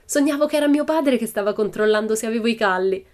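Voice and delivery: put-on high, lively girl's voice, freshly awake; a spirited little girl, amused; Pippi, cheeky girl. A narrator's reading. Pippi teasing her friends on waking, high and playful.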